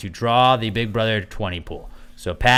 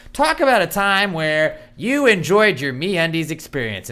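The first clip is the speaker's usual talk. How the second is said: mock formal copy; 7 semitones higher, 4 dB louder than usual. Quoting stiff ad copy.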